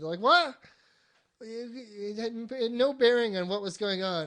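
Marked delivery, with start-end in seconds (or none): 0.2-0.6 loud, high, incredulous yelp; dumbfounded. Retold or imitated. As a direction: blurt it in re-enacted disbelief. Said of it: "fucking dumbfounded".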